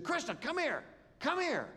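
urgent high-pitched come here; excited calling out. Retold or imitated. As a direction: call her over, thrilled. Calling Krista across the room.

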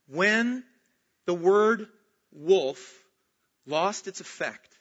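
word by word, with pauses; deliberate emphasis. Real, not acted. Preacher driving home the story's lesson, slow and emphatic. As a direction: spell out the point slowly, pausing between words. Key point made word by word.

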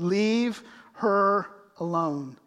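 slow, deliberate commanding words; firm command. Acted. Jesus firmly defending Mary, slow commanding. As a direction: say it slowly like Jesus protecting her. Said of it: "he said".

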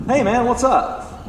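slow, high-pitched casual hail; mock casual greeting. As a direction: imitate a laid-back, chummy greeting. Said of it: "this isn't a casual thing".

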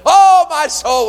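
loud, high, drawn-out swoon; hammed-up rapture; smitten exclamation. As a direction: cry it out as if madly in love. Acting out smitten spouse.

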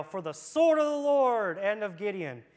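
loud shouted battle cry; demo yell. Shown as a demonstration. Preacher demonstrating the yell for the children, loud. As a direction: show the kids how to yell it. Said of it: "y'all are going to yell".

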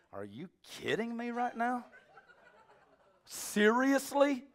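high-pitched disbelief; acted disbelief, stretched out high; incredulous rebuke. Preacher models answer to whining man, incredulous.

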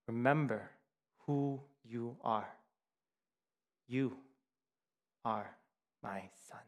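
slow, fading, soft; a lightly voiced ghost father, solemn; solemn ghostly father. Mufasa's ghost reminds his son, slow and fading to soft.

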